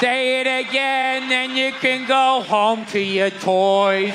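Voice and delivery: slow, drawn-out condescending teacher voice; mock church teacher. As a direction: talk down slowly to a child. Mocking church teacher to child.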